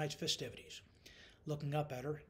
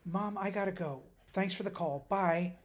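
hurried sign-off; 6 semitones higher, 6 dB louder than usual. A narrator's reading. Rushing off the phone when late.

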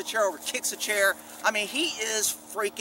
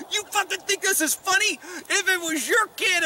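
angry father's yell; 10 semitones higher than usual. Retold or imitated. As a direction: yell it back like the furious father. Retelling the father yelling at him.

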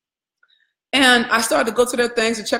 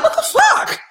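much higher, louder, stunned exclamation; shocked disbelief; 3 dB louder than usual. Retold or imitated. Coach recalling learning the mentor's hardship, in stunned disbelief. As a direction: gasp it out in shock. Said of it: "I was like".

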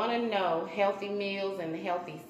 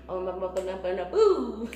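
laughing; 5 dB louder than usual. Real, not acted. Laughs while saying.

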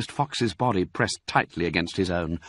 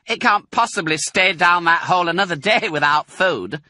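woman voice; 11 semitones higher, 5 dB louder than usual. A narrator's reading. Women calling between floors.

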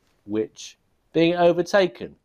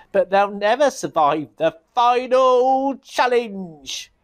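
higher, slow sly wizard voice; Burger Wizard voice; 10 semitones higher, 4 dB louder, slower than usual. Acted. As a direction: reassure the king slyly. Burger Wizard's confident reply.

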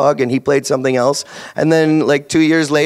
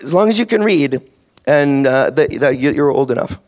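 usual speaking voice

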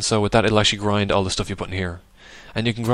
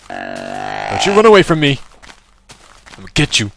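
yelling at a fleeing animal; 6 semitones higher, 8 dB louder than usual. Real, not acted. Player yelling at a mob running away from him. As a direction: shout after it to stop it escaping.

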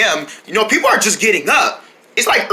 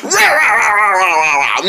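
dinosaur roar. Acted. Toy dinosaur roaring.

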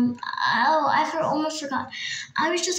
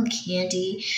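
normal talking voice

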